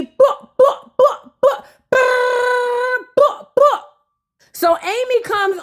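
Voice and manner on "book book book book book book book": high, punchy mouth beat; beatbox the intro; vocal drum beat; imitated beat. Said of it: "give it up for Amy".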